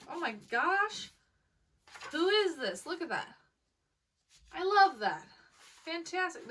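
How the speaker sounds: thrilled. Real, not acted. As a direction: squeal with real delight at the signature.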